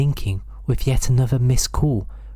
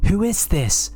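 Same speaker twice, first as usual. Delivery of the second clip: a high, insistent demand; 7 semitones higher than usual. Acted. Woman demanding the silent caller identify himself. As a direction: press for an answer, pitch raised. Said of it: "She demanded again".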